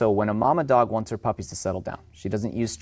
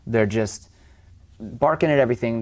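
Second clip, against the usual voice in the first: normal talking voice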